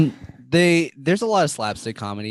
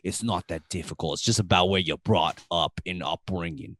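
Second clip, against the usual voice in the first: put-on New York Italian accent; 4 semitones lower than usual. [name] defends being from Staten Island, New York Italian accent. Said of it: "with a New York Italian accent".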